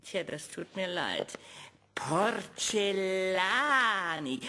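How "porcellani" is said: stretched slow roll call. Acted. Doctor calls the name again, slowly. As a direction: call the name, pausing on each syllable.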